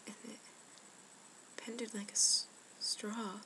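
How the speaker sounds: normal talking voice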